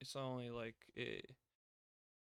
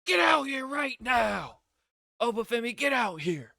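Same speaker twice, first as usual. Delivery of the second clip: roaring much higher and louder, slowed at the start; Brock's challenge shout; 12 semitones higher, 14 dB louder than usual. Retold or imitated. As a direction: bellow it across the arena at Oba Femi. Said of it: "and he goes".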